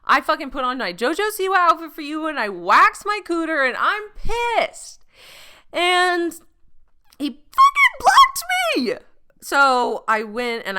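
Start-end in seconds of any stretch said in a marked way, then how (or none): 0.0-5.0 higher, louder furious rant, slowing on pissed; building angry tirade. Retold or imitated. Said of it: "I was like". 5.7-8.9 way higher and louder, near a shriek; indignant screech; outraged shriek. Real, not acted. Outraged punchline about being blocked.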